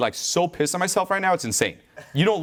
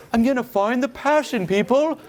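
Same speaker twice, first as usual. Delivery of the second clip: higher-pitched fake speechifying; mock passionate declaration; 4 semitones higher than usual. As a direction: imitate a hollow motivational pitch. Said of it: "I'd talk about that versus".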